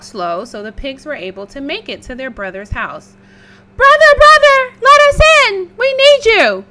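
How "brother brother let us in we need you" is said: very high, loud pleading; desperate pig. Acted. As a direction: beg frantically to be let in. Brown begging at door.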